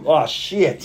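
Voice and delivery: ordinary talk, nothing marked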